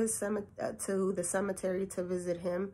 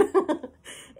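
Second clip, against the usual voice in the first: high burst of laughter; 8 semitones higher than usual. Real, not acted. Laughing at her answer to the email, high laugh. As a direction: laugh out at your own blurted answer.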